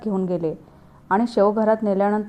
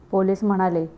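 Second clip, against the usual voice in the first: ordinary delivery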